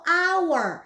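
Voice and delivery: hour said slowly as "awer" with no h; silent h in hour. Shown as a demonstration. Teacher slowly shows how to say hour. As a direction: stretch out hour, dropping the H. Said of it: "Hour-nya itu dimulai dengan huruf H".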